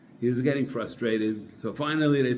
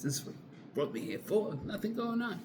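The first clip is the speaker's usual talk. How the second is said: hushed complaint to the man beside him, much softer; quietly; 9 semitones higher, 9 dB quieter than usual. Retold or imitated. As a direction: grumble it under your breath so nobody else hears. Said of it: "like, you know, quietly".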